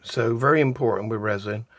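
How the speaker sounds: usual speaking voice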